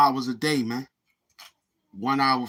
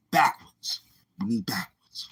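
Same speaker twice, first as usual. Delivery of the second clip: hushed unvoiced whisper; whispered aside. Real, not acted. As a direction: whisper it off-mic.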